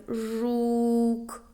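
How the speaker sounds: slow sounding-out of "жук", vowel sustained; drawn-out vowel. Shown as a demonstration. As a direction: stretch the у in "жук" on one held note. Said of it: "заховався в слові".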